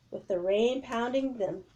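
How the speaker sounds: usual speaking voice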